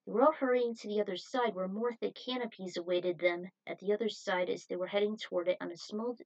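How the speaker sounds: usual speaking voice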